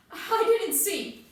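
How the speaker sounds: high, loud, drawn-out protest; distressed denial. Acted. Sonny answers the interrogator's accusation, distressed denial.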